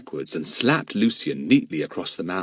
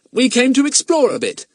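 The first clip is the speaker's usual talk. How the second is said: put-on much higher, louder boy's voice; boy Jack; 6 dB louder than usual. Jack explaining himself to Mister Eppie, boyish protest. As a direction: answer as a high-voiced, defensive boy. Young Jack's character voice.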